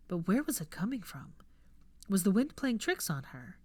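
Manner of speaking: ordinary delivery, nothing marked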